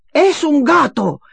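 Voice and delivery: high, loud slow exclamation; exclaiming. Acted. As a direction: blurt out the discovery. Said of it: "exclamó el varón". (exclamación fuerte y aguda)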